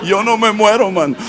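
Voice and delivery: words broken by laughter; laughing. Real, not acted. Shrugging off death, laughing. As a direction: laugh through the boast.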